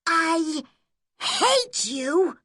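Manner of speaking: put-on high, shrill-free furious cry of a girl; angry shout. A narrator's reading. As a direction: shout it at her with hurt rage. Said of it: "she shouted angrily".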